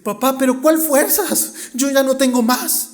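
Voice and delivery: a little desperate. Retold or imitated. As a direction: act a discouraged child giving up. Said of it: "un poquito desesperado y desanimado". (niño algo desesperado y desanimado)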